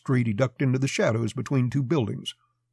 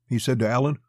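usual speaking voice

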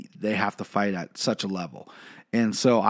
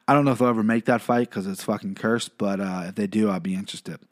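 usual speaking voice

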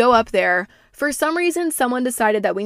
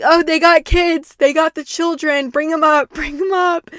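higher and louder, thrilled, breaking into a laugh; excited; 8 semitones higher, 6 dB louder than usual. Retold or imitated. Retelling cheering the dilf's boat up to the dock, excited laughing. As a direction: cheer them in like the best news all day.

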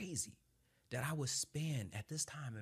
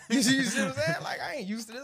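loud laughing disbelief, much higher; 13 dB louder, faster than usual. Real, not acted. Telling the host about the crying Marine, laughing loudly. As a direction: laugh it out, can't believe it.

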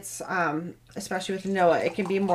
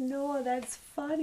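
much higher, softer, tickled; amused praise; 5 semitones higher, 10 dB quieter than usual. Retold or imitated. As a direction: praise the joke fondly up high. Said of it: "I'm like".

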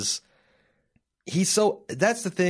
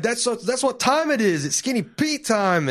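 high-pitched, cocky stoner swagger; Skinny Pete; 11 semitones higher than usual. Acted. Podcaster quoting a scumbag character played for laughs, high cocky bravado. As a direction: announce yourself with comic bravado. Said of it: "from his bravado".

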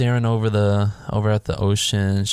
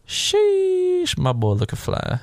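a high, drawn-out "Sheesh" cheering him on; hyped exclamation; much slower than usual. Retold or imitated. Host reading his own notes aloud, high and hyped. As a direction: read your note out like a cheer. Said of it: "I literally put in my notes".

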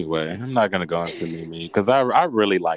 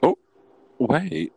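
ordinary delivery

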